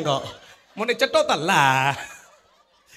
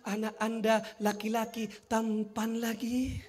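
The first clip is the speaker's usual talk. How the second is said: midwife voice; 6 dB quieter, slower than usual. Retold or imitated. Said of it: "Kata bidannya".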